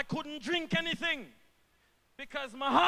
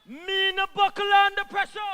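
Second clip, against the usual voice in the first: louder and higher, daring anyone to doubt it; defiant loud repeat; 6 semitones higher, 7 dB louder than usual. Real, not acted. Minister answering critics, loud defiant repeat. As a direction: rise up on the second take. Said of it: "repeats line for emphasis".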